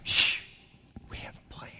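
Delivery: hushed whisper. Retold or imitated. Second guy shushes the littlest one in a whisper. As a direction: shush him and whisper the secret plan. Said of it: "And they go".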